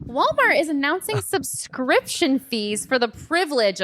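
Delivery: lightly voiced mock newsreader; mock announcer. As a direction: announce it like a sarcastic headline. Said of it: "mock news announcement".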